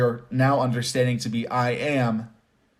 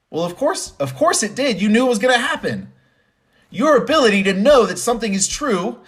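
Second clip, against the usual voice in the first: sarcastic laugh; 9 semitones higher, 3 dB louder than usual. Acted. Mocking the obvious outcome.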